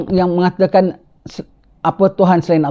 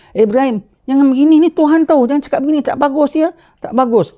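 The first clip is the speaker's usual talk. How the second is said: suara jauh lebih tinggi, menegur; teguran orang kampung; 9 semitones higher than usual. Acted. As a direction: tegur Ibrahim dengan nada tinggi dan risau. (orang kampung menegur Ibrahim dengan suara tinggi)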